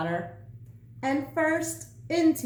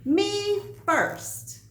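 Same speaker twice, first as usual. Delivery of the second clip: greedy little "Me first"; cried out; 8 semitones higher than usual. A narrator's reading. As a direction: shout it like a pig who must be first. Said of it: "he cried".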